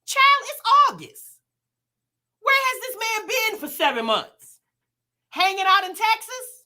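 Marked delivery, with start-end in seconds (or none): 0.1-4.6 exasperated. Real, not acted. Commenting on the live clip, exasperated and incredulous. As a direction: throw your hands up in exasperation.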